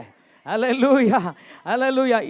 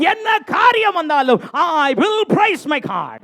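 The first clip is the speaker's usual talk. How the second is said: high, loud climax easing slightly; impassioned preaching cry; 6 semitones higher, 4 dB louder, slower than usual. Real, not acted. Preacher vows to praise through any trouble, impassioned shout. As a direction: cry it out as a defiant vow of praise.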